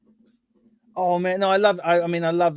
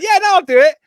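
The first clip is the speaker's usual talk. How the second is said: high, loud mock eagerness; self-mocking, high-pitched yes; over-eager agreement; 11 dB louder, faster than usual. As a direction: blurt it out as a keen pushover. Mimics own eager giving in.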